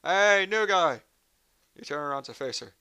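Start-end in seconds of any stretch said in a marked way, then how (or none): 0.0-1.0 loud, high, slow hey, new guy; shout. Acted. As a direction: yell across the hallway.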